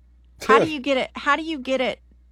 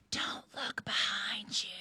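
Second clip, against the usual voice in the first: eerie ghostly whisper, slow; distant whisper; 14 dB quieter, slower than usual. Pretend. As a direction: breathe it like a far-off ghost. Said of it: "a distant whisper".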